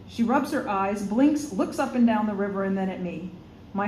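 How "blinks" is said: plain reading voice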